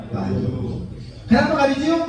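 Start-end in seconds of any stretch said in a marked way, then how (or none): 1.3-2.0 "Kenapa nggak dicium?" asked much higher, rising on dicium; high teasing question. Shown as a demonstration. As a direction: toss it up high to the congregation. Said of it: "kalimat ini harus dihafalin".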